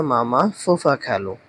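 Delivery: normal talking voice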